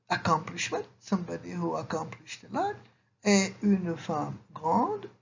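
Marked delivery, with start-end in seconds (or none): 3.2-5.1 slow and deliberate. Shown as a demonstration. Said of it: "teacher demonstrates word order".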